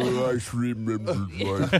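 slow, drawn out Lugosi accent. Shown as a demonstration. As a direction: stretch each word out slowly. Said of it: "slowing it down".

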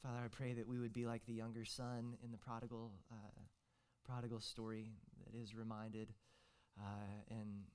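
hushed pastoral prayer; quiet prayer. Real, not acted. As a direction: pray gently to the congregation.

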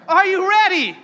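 high and loud, insisting on an answer; loud rallying call. Real, not acted. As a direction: call out to the back row. Repeats call for response.

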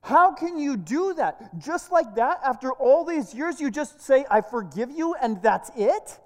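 loud, high, incredulous protest; indignant outburst. Acted. Reporter protests her forgiveness, loudly indignant. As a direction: raise the voice in outraged disbelief. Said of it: "the reporter is now indignant".

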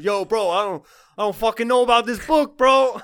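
high, loud bro voice, building; hammed-up bro talking about a book; dude bro voice. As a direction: play a skeptical medieval dude bro. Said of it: "why they're talking like fucking".

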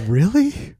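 high incredulous "Really?"; mock surprise. Retelling the drunk's amazed question, a high mock surprise. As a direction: play up the amazement.

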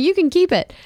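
high, airy, tossed-off line; breezy quip. Retold or imitated. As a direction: hand it over with a carefree laugh. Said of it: "I was like".

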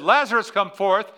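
loud, high, booming call; dramatic preacher's cry. Retold or imitated. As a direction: throw it to the back row. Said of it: "But when I said".

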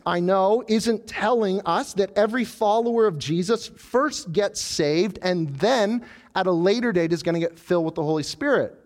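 normal talking voice

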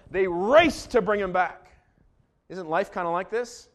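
ordinary delivery

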